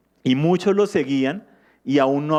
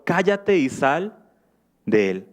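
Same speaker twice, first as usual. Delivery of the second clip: stern rebuke; slower than usual. Acted. Jesus rebukes the demon.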